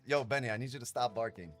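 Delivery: ordinary talk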